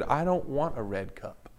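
put-on soft, slow whiny child's voice; whiny little girl. Preacher voices the girl refusing the red cup, a hint of whine. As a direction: mimic the girl whining over the red cup. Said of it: "in a very whiny voice said".

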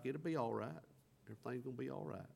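soft, hushed reassurance, speeding at the end; gently calm him down; reassuring. Retold or imitated. Wife soothing husband that all will be well, soft. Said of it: "She's like".

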